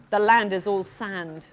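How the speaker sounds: ordinary delivery, nothing marked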